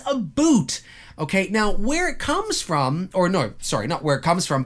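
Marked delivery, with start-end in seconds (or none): 0.1-0.8 "a boot" for "about"; mock Canadian "about". Shown as a demonstration. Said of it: "nobody says".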